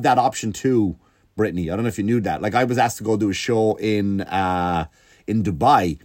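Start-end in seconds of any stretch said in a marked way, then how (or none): none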